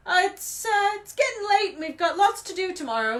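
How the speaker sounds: loudly change the subject; flustered, hearty interruption. A narrator's reading. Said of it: "said Hagrid loudly".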